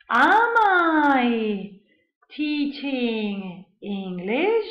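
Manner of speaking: drawn-out syllables, rising at the end; slowly modelled example question; slow teaching model. Shown as a demonstration. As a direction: stretch each word out for learners.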